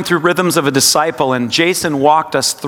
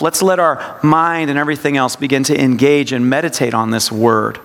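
normal talking voice

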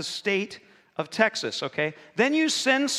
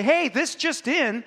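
slow, higher-pitched breaking-news announcement; TV news anchor; 6 semitones higher, slower than usual. Acted. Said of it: "all those guys would say".

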